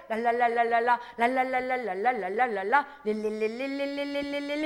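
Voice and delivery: only the tongue flicks, 랄랄라 and 릴릴리 repeated; tongue-only la-la babble; beginner's tongues. Shown as a demonstration. Preacher shows beginners' first tongues, la-la babble. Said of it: "맨 처음에는 어떻게 해요".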